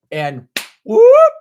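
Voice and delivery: a high, loud whoop; sudden whoop. Retold or imitated. Describing a song's pull, with a sudden high loud whoop. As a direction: yelp it out like being yanked back in time. Song snaps you right back.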